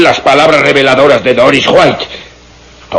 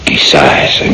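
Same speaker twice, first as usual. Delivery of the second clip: whisper; 12 semitones lower than usual. Acted. Doctor doubting inspector's theory.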